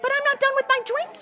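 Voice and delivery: high-pitched whine; whiny protest. Acted. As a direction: protest like a drinker losing the glass. Told to stop drinking, protests.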